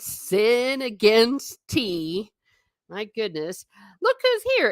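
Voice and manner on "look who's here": excited greeting. Real, not acted. Greeting a viewer joining chat.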